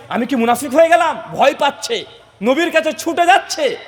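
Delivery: high, ringing sermon delivery; impassioned preaching. A narrator's reading. Preacher telling of Hanzala doubting his faith, impassioned. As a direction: declaim it urgently, pitch held up.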